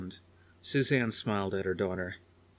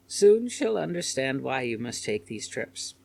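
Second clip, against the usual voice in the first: lightly voiced concerned old lady; 5 semitones higher, 4 dB louder than usual. A narrator's reading. Sitter speaks.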